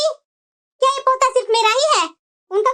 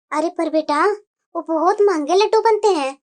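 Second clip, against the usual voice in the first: worried mother voice; 10 semitones lower than usual. Acted. Mother worried about costly laddoos, low hesitant voice. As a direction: fret about the expense.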